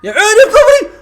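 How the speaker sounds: high, loud burst of admiration; delighted exclamation. Real, not acted. Fan loving a nutmeg, delighted exclamation. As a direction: cheer the skill.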